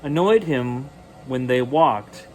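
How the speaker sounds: ordinary delivery, nothing marked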